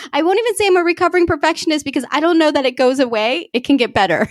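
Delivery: fast, high, laughing confession; high laughing admission; laughing while talking. Real, not acted. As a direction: rush through it with a laugh in the voice. Laughing about perfectionism.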